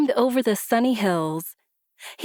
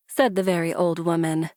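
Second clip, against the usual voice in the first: usual speaking voice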